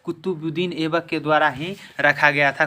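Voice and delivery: ordinary talk, nothing marked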